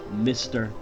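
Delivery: usual speaking voice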